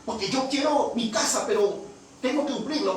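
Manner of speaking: high, eager acted questioning; impatient pressing. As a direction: nag the pastor for an answer. Acts out woman pressing for answer.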